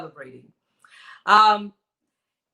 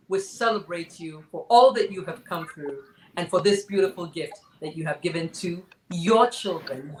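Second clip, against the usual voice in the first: ordinary talk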